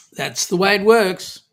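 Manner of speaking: usual speaking voice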